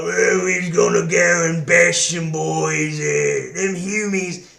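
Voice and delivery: slow, loud Cockney orc shout; hammed-up Cockney orc yelling. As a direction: bellow like a Cockney orc brute. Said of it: "East London disgusting Cockney accents".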